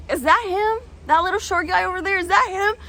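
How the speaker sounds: lightly voiced eager friend; curious best friend. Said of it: "my best friend is like".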